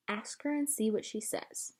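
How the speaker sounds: soft, hushed library voice; whispered. A narrator's reading. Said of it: "Miss Franny whispered".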